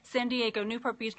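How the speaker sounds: normal talking voice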